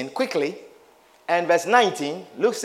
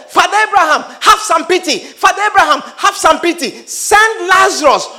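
anguished shout; 7 semitones higher, 4 dB louder than usual. Acted. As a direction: scream for help from the fire. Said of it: "The rich man shouted".

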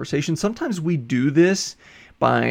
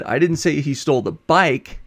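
BIKE hit firmly at the end; stress on BIKE. Shown as a demonstration. Lecturer implying something else was stolen, stress on "bike". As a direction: save the emphasis for the final word "bike". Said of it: "but he stole something else".

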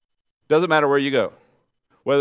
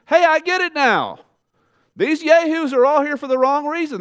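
much higher, louder, excited disciples' voice; realizing disciples; 12 semitones higher, 5 dB louder than usual. Retold or imitated. Preacher voices the disciples realizing why the crowds came, excited. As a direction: burst out like a disciple who finally gets it. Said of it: "the disciples are saying".

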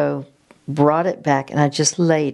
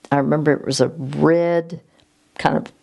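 ordinary talk

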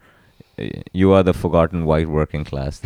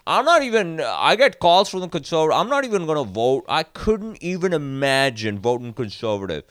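high mocking voice; 9 semitones higher than usual. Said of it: "And he's like".

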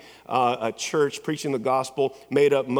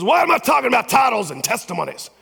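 high, loud rhetorical preaching question; impersonating a fiery preacher; 10 semitones higher, 6 dB louder than usual. Retold or imitated. Pastor asking graduates why he preaches about titles, loud. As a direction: throw the question out to the congregation. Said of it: "He goes".

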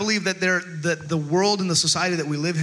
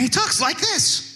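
high, drawn-out squeaky impression; imitating Texan comedian; 10 semitones higher, slower than usual. Pastor showing how a skinny Texas comedian talks, high-pitched. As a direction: put on a much higher, slower voice. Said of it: "That's his real voice".

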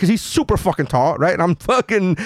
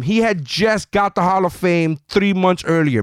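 normal talking voice

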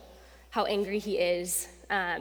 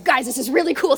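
"really cool" louder, higher, eager; louder excited retake; excited hype; 3 semitones higher, 9 dB louder, faster than usual. Shown as a demonstration. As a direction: pump up "really". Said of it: "I sound like Russell".

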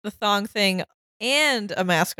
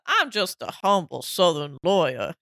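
Southern lawyer voice; 6 semitones lower than usual. Retold or imitated. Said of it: "voice, you know?".

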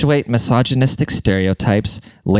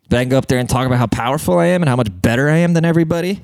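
ordinary talk, nothing marked

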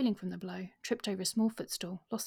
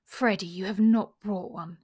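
loud, slow disbelieving demand; incredulous; 10 dB louder than usual. A narrator's reading. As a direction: demand it, unable to believe it. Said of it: "she demanded incredulously".

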